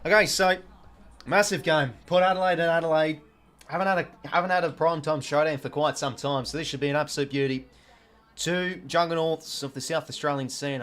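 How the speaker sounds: sportscaster energy calling the match; live footy commentary. Acted. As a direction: call the game like a TV commentator.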